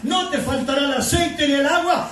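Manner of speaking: rising, shouted preaching with a laugh in it; fervent sermon shout. Acted. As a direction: proclaim the promise to the congregation. Preaching at full force.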